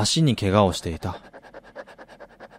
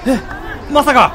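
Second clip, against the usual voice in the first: can't believe what he's seeing; astonished. Acted. Fan gasping as the forward breaks clear.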